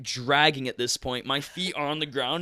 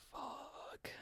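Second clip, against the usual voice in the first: voiceless, very soft curse; dismayed whisper; 21 dB quieter than usual. Retold or imitated. Patient told he needs parent consent, dismayed whisper. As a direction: breathe it out under your breath.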